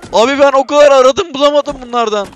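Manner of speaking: high, loud burst of relief; loud excited relief. Real, not acted. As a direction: blurt out the long search. Relieved after long search.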